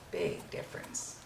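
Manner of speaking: breathy whispered repeat for emphasis; whispered echo. Shown as a demonstration. Painting teacher echoing her point to viewers in a whisper. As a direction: drop to a whisper to drive it home.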